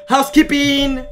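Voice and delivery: high, slow drawn-out call; housekeeping call. Pretend. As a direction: sing out to announce housekeeping. Calling out as hotel housekeeping.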